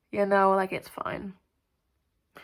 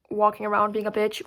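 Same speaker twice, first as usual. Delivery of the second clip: ordinary delivery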